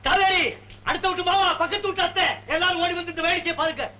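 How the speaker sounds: high, carrying call to the neighbours; mocking call. Husband calls the neighbours to watch his wife, high mocking call. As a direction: summon everyone to laugh at her.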